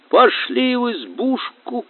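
высокий, протяжный клич; позови всех высоким голосом; выкрик из толпы. A narrator's reading. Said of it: "крикнул кто-то". (звонко выкрикнув)

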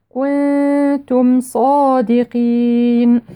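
very slow chanted كنتم صادقين, long hum and held ee; prolonged recitation. Shown as a demonstration. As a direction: stretch the final vowel of صادقين before the n. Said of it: "والمد عارض للسكون".

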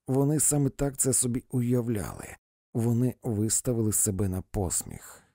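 plain reading voice

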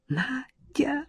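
high, hushed crying call; girl bursting into tears on the name; tearful. A narrator's reading. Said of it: "заплакала она".

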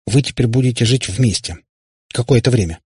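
ordinary delivery, nothing marked

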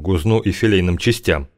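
usual speaking voice

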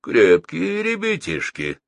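high, slow, uneasy musing; nervous thought. A narrator's reading. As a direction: think aloud with a touch of nerves. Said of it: "нервно подумал Мамис".

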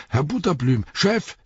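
high-pitched, startled call for the boss; excited servant calling his master; surprised cry. A narrator's reading. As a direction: cry out in astonishment. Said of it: "schrie er auf vor Überraschung".

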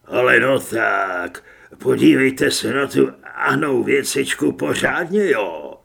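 loud, throaty crook's rasp. A narrator's reading. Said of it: "chrchlal bez ohledu na úžaslého kněze". (hlasité bezohledné chrchlání)

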